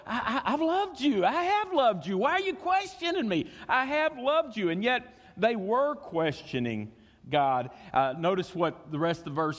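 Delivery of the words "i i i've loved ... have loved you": high, indignant, laughing protest; hammed-up defensive spouse. Spouse across the table, hurt and indignant protest. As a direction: play the hurt partner insisting they loved. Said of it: "You know".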